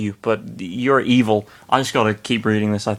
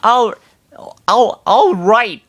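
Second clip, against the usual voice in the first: higher, slow reluctant agreement; disappointed; 10 semitones higher, 3 dB louder, slower than usual. Acted. Lynn reluctantly agrees to surrender, disappointed. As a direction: give in reluctantly.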